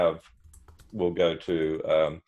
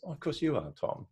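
quick sweet reply; 7 semitones higher, 7 dB quieter than usual. Retold or imitated. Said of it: "quick as a wink, she goes".

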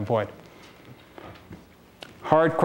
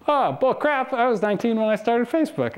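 much higher, mock scoffing dismissal; hammed-up incredulous founder; imitating Mark; 8 semitones higher than usual. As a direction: brush the excuse off like Mark did. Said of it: "He goes".

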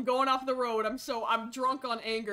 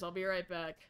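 normal talking voice

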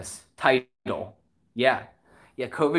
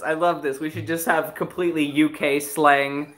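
ordinary talk

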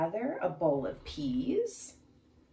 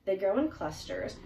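ordinary delivery

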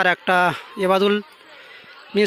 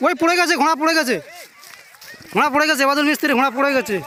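excited high call as horse falls; race caller; 7 semitones higher than usual. Real, not acted. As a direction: shout out the fall like a race caller. Horse falls during live race.

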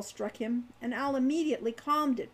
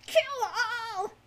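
very high, wailing creature screech; shrill with hate; 12 semitones higher than usual. A narrator's reading. Captive kobold crying for slaughter, shrill hate. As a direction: shriek it with shrill hatred. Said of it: "his voice shrill with hate".

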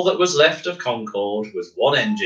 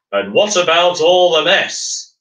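big voice; 6 semitones higher, 5 dB louder than usual. Acted. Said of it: "said the big voice".

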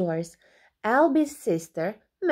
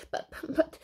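stammered whisper; 6 dB quieter than usual. A narrator's reading. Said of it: "said Alby".

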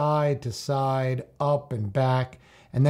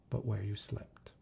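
whisper; 4 semitones lower, 13 dB quieter than usual. Acted. Whispered end of proverb.

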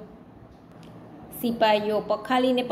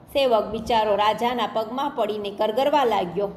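ordinary delivery

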